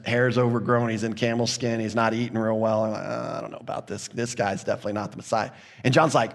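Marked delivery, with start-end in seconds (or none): 2.9-5.5 low, quick, doubtful aside, much softer; doubtful mutter. Retold or imitated. Officials doubting John, a quick unconvinced mutter. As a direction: mutter it under your breath, fast and unconvinced.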